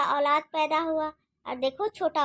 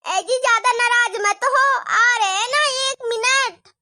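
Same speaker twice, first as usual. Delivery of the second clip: high, louder, slowed pleading; pleading wife; 7 semitones higher, 7 dB louder than usual. Acted. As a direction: beg him to calm down, you're coming. Wife pleads with angry husband. (पति को मनाती बहू, ऊँची गिड़गिड़ाती आवाज़)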